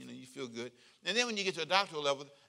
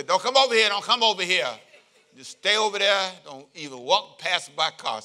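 loud, high, laughing mock warning to stay away; hammed-up yell to keep people off the car; mock shout; 4 semitones higher, 10 dB louder than usual. A member shouting at the pastor to stay away from her car, mock loud. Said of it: "I've seen them say".

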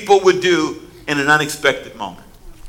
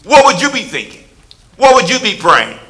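ordinary delivery

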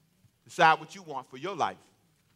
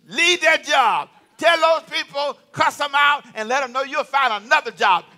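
pushy advisers; 8 semitones higher, 7 dB louder than usual. Acted. Friends urging you to quit, loud and pushy. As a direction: bark the advice like a cheerleader. Quoting bad advice givers.

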